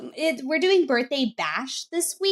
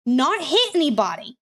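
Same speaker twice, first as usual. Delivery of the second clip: ordinary talk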